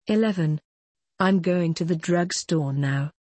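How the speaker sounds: ordinary talk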